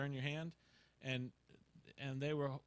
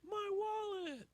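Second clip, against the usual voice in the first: high, drawn-out exclamation; elated commercial guy; much slower than usual. Retold or imitated. Man finding his wallet outside Little Caesars, hammed-up joy. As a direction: mimic an overjoyed ad character, slow and high. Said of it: "the greatest day of his life".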